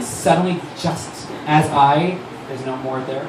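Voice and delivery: ordinary delivery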